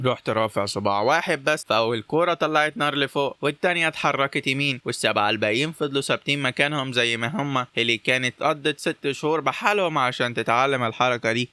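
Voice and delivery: usual speaking voice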